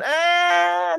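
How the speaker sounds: long, high drawn-out hedge; mock waffling. Coach backing out after the announcement, mock waffling. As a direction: stretch a high noise of second thoughts.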